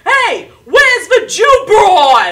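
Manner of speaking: put-on old-fashioned Italian man bellowing; slow, high, full-force holler; yelling across a bar. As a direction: shout it across the entire bar. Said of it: "he's yelling".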